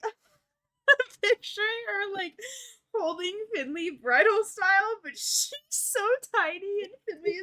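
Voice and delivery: giggling while picturing it; laughing speech. Real, not acted.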